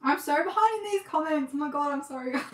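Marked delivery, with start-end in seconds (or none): none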